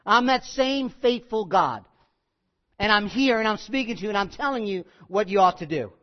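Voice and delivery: ordinary delivery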